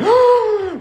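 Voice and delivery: high startled gasp of realization; sharp shocked gasp. Retold or imitated. Comic realization at the ringing phone, a high gasp. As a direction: gasp as it dawns on him.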